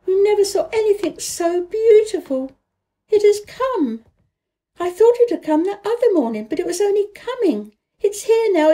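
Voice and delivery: high and loud, bubbling with joy; excited girl. A narrator's reading. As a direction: pour it out, thrilled about spring. Mary bursts in with news.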